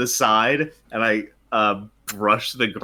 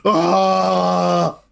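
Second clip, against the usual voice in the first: loud, higher war cry at the sky; yell to the sky; 8 semitones higher, 7 dB louder than usual. Acted. Kronk yelling into the sky after throwing the bird, full-throated. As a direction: unleash a cry to the gods. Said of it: "and goes".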